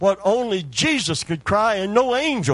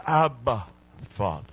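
intimate, tender; 5 semitones lower than usual. Shown as a demonstration. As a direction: say it like a child to his dad. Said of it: "That's an intimate term".

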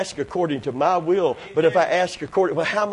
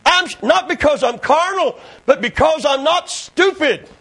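raised, driving preacher voice; fervent preaching; 6 semitones higher, 3 dB louder than usual. Real, not acted. Preacher urging congregation to possess the land, fervent preaching. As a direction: press it home to the congregation.

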